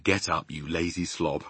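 understated fatherly telling-off; father's real voice. A narrator's reading. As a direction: drop low to rouse a lazy child. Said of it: "hearing my father's real voice".

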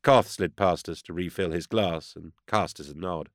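ordinary delivery

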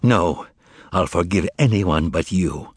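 cold, contained contempt; calm hatred. A narrator's reading. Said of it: "with calm hatred".

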